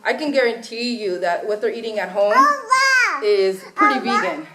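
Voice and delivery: normal talking voice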